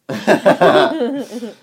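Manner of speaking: a sudden loud burst of laughter; big laugh. Real, not acted. Answering her partner's cheeky sister question with a loud burst of laughter.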